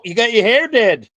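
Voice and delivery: playful Southern twang; Southern accent. Acted. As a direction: tease her hair in a mock Southern accent. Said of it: "enough Southern accents here".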